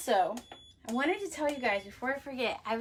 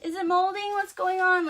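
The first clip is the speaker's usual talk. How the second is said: higher, louder mock panic; alarmed beginner; 7 semitones higher, 6 dB louder than usual. Soap beginner seeing brown speckles, mock alarmed. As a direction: sound suddenly worried about mold. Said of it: "you're like".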